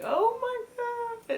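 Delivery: high, slow, tearful gasp of a line; overwhelmed, about to cry; choked-up oh my god. Real, not acted. Vlogger moved to tears by a gift, crying out. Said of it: "I'm like".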